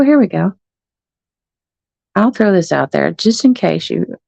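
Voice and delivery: ordinary delivery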